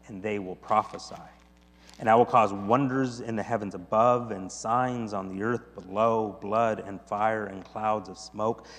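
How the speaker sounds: low, measured reading of prophecy; reverent scripture reading. Acted. Preacher reading Joel's prophecy to the congregation, reverent. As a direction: let it sink low and solemn.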